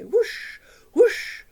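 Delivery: loud rising wind whoosh as the mouse flies by. Acted. Mouse sailing past on windmill.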